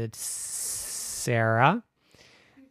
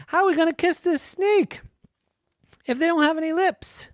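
much higher, louder, bewildered; puzzled little girl; 6 dB louder than usual. Acted. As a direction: squeak out the problem.

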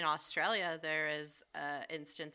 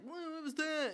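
startled farmer; 12 semitones higher than usual. Retold or imitated. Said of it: "He's like".

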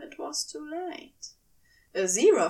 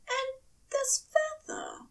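tearful; 4 dB quieter than usual. Acted. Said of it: "feather found, voice cracking".

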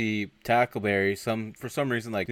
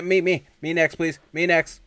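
high, loud, eager pleading; mock eager volunteering; 8 semitones higher, 7 dB louder than usual. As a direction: imitate a crowd begging to be picked. Retold eager crowd of volunteers.

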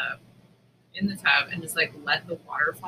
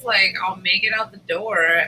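ordinary delivery

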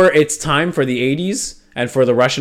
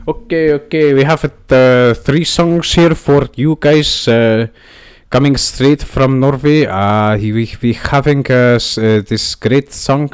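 slow, louder mock Norwegian announcer; Norwegian accent; 4 dB louder than usual. Host reads the outro songs in a fake Norwegian accent. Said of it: "I'll work on the Norwegian accent".

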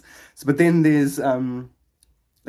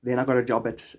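usual speaking voice

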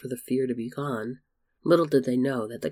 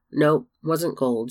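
slow, louder, drawn-out evasive reply; evasive answer; 3 semitones higher, 5 dB louder, slower than usual. Acted. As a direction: stretch the words out to dodge the question. Wasn't gold.

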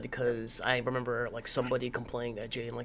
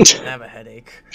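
tired whisper; 20 dB louder than usual. Real, not acted. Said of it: "I sound so low energy today".

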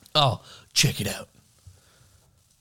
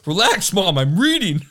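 put-on cheeky kid; 6 semitones higher, 4 dB louder, slower than usual. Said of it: "be like".

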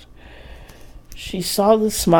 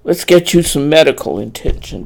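insistent; 8 dB louder than usual. Acted. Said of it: "Brad insisted".

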